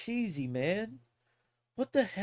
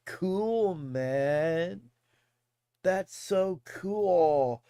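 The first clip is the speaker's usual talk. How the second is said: drawn-out, laid-back slang impression; seventies cool dude; much slower than usual. Showing how they talked in the seventies, as a drawling cool dude. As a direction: stretch it out like a seventies hipster. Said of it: "They would say that in the seventies".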